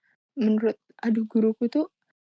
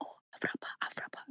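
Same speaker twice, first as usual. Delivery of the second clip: bisikan pelan teman-teman sekelas; meniru bisikan; 17 dB quieter than usual. Retold or imitated. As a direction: tirukan murid berbisik menyahut ke guru. Teman sekelas menyahut ke guru.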